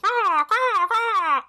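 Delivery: high squawking caw; screechy seagull voice. Retold or imitated. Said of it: "I don't think that was a good seagull impression".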